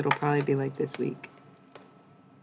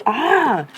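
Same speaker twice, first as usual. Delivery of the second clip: sudden yelp, much higher and louder; a sharp cry of surprise; startled yelp; 11 semitones higher, 12 dB louder than usual. Real, not acted. Startled by incense.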